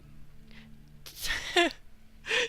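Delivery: high airy giggle under the breath; high laugh. Acted. Koji laughs, backing Oyumi.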